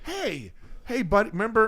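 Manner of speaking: high-pitched mock call-out; calling across lobby. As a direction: hail him from across a lobby, playfully. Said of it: "it's out loud across the lobby".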